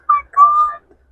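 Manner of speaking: high, squeaky excited squeal; real fangirl squeal. Real, not acted. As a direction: squeal it out in delight. Squeals over the love line.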